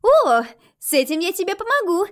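put-on high, loud happy little girl; bursting with eager joy; delighted. A narrator's reading. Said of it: "обрадовалась девушка".

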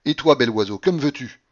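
loud, scornful challenge; full-voiced shouting. A narrator's reading. Babouchka to mademoiselle Blanche, shouting. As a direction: round on the next offender. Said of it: "elle criait à pleine voix".

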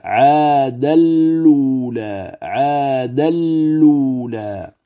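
very slow "عاد اللولى" with doubled lam, no nasal; Warsh recitation. Shown as a demonstration. Teacher demonstrates Warsh reading of the word, slow recitation. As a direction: draw out "عاد اللولى" slowly, full merge into the lam. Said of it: "فتلفظ هكذا".

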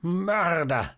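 high, shrill shriek of accusation; crazed, ringing cry; piercing scream. A narrator's reading. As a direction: shriek it out, out of your senses. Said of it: "tönte aber die gellende Stimme".

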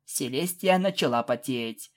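ordinary delivery, nothing marked

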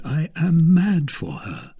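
ardent, lingering avowal; lovestruck. A narrator's reading. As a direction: draw it out like a smitten young man. Lover declares his passion.

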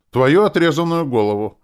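high, loud, gleeful answer; keen, savouring menace; eager reply. A narrator's reading. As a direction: answer with relish, pitched right up. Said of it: "охотно ответил он".

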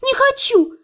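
high, upset cry of refusal; defiant refusal. A narrator's reading. Patient squirming away from necromancer, high defiant refusal. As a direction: snap back with pitch leaping up. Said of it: "заявила я".

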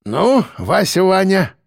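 lightly voiced eager boozer; eager toast. A narrator's reading. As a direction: launch a toast with relish. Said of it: "в глазах у него блеснул азарт".

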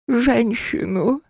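very high, slow, eerie demand; spirit voice. Acted. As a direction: savor the word hungrily.

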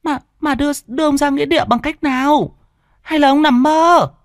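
frightened wife. Acted. Frightened wife questioning husband.

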